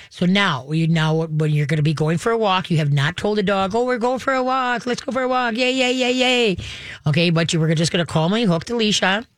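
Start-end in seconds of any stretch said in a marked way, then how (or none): 3.7-6.6 high, singsong hyped-up dog owner; mock excitement. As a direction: imitate an owner revving up the dog, pitched high. Mimics owner hyping up the dog.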